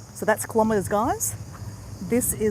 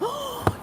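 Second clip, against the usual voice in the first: excited gasp; 3 dB quieter than usual. Real, not acted. Sudden excited idea.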